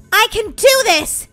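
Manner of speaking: slow, emphatic resolve; determined. Pretend. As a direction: declare it slowly, with growing nerve. Repeats with firm resolve.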